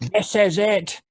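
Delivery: resolute shout. Acted. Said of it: "Richard resolutely shouts".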